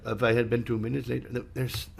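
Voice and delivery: fast, even, no word stress; rushed flat delivery. Shown as a demonstration. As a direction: rattle it off with no emphasis. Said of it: "we lose part of the meaning of the story".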